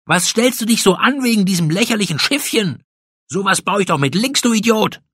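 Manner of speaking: fast, high-pitched scolding; angry scorn. A narrator's reading. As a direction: rattle off a dismissive insult. Acted quarrel, insults back.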